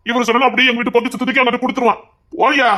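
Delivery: ordinary talk, nothing marked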